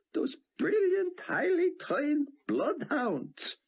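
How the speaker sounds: mock praise gasped through tears of laughter; laughing so hard. Theon mocking the officers to Buck, laughing helplessly. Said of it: "He laughed so hard he could hardly breathe".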